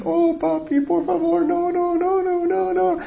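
voz de niña, mucho más aguda y suave; niña suplicando. Retold or imitated. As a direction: suplica como una hija que llora encerrada. Hija llorando suplica, voz aguda. (niña que ruega llorando, aguda y suave)

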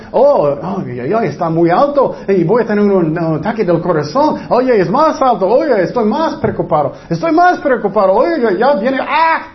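hammed-up worrier spiralling into alarm; imitating a panicking man sure the heart attack is coming; mock panic. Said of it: "Porque están preocupados".

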